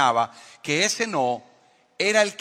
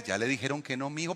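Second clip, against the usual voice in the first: calm voice of God; 4 semitones lower, 9 dB quieter, faster than usual. Pretend. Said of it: "God answering softly".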